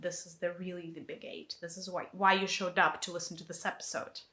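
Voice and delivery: ordinary delivery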